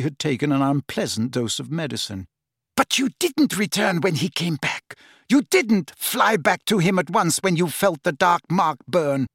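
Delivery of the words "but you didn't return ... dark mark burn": high-pitched interrogating villainess; sharp, high cross-examination; Bellatrix, accusing. Acted.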